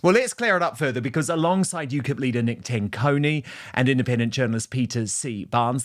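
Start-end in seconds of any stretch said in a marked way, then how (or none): none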